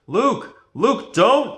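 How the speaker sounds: high, loud desperate call. A narrator's reading. Leia calls out to Luke as she's dragged away, loud and high. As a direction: shout a warning across the hall. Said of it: "Leia called".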